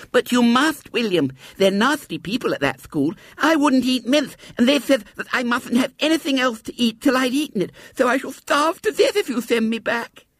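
put-on very high lisping child's protest; pleading little girl Violet Elizabeth. A narrator's reading. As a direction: plead urgently in a piping child voice. Narrator voices Violet Elizabeth pleading.

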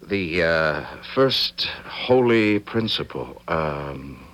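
slow, halting recital; groping for the words; hesitant recital. Acted. Son fumbling the ritual before his father, slow.

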